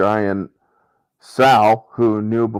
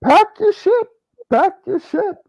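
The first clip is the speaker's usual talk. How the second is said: high frantic order. Retold or imitated.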